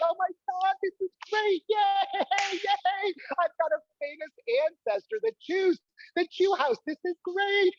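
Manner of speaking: put-on high, giddy childlike squeal of joy; gushing glee. Comic telling his audience about his ancestor, high giddy delight. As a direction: squeal it like a kid finding treasure.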